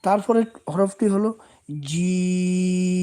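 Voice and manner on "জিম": জিম held very long on one steady pitch; three-count stretch. Shown as a demonstration. Quran teacher demonstrating the three-alif stretch of jim. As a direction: stretch জিম slowly for three beats, then stop. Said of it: "তিন আলিফ টান হবে".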